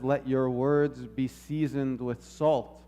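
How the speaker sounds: ordinary talk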